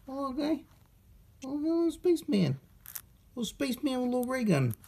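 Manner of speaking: high, soft cooing over the toy. Pretend. Unboxer cooing over a little spaceman toy. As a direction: gush quietly over the tiny spaceman.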